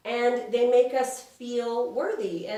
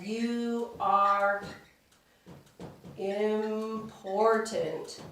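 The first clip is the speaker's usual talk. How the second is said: very drawn-out, with a pause before "important"; slow dictation; much slower than usual. Shown as a demonstration. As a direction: spell it out slowly as you write. Writes out what Jesus says.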